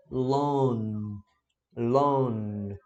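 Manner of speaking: drawn-out 'Long' stopping on the N; silent G. Shown as a demonstration. Teacher repeating the correct ending, silent G. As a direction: hold the N of 'Long', leave G unreleased. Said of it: "Só vai parar no".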